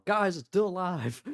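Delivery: high, loud warning to the party; in-character yell. Acted. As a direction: yell it out to your companions. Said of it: "The others hear me yell".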